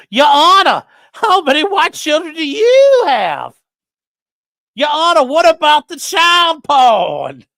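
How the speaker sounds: high, loud, slow shouted question; high slow shout of a mocking courtroom question; mock courtroom holler. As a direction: holler it at the judge, drawn out. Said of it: "we were hollering".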